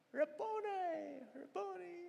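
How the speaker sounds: high, slow, tender calling of Rabboni; Mary's awed recognition. Acted. Mary answering Jesus, high and slow in awe. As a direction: let it rise in wonder, slowly.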